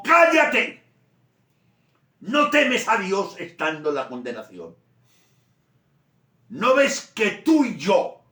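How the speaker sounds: very high, loud rebuke; raising his voice. Acted. Good thief rebukes the other, shouting past Jesus. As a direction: shout across the cross to silence him. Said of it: "tuvo que levantar la voz".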